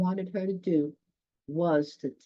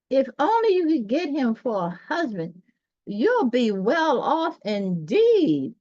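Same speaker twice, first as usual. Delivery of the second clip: field mouse; 6 semitones higher, 6 dB louder than usual. A narrator's reading. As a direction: coax her toward the rich mole. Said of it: "she said".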